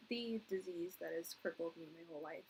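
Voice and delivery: ordinary talk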